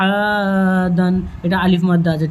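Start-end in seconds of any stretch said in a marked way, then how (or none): 0.1-1.1 আদান with the opening আ held long and level; stretched madd. Shown as a demonstration. Teacher showing the one-alif madd, long আ in আদান. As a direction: draw out the আ of আদান before a short দান. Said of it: "এক আলিফ টান হবে".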